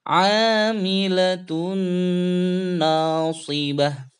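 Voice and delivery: slow chanted Amilatun with nasal hum; slow tajwid chant; Quran recitation. Shown as a demonstration. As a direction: hum the nun for two beats. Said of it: "Bacanya seperti ini".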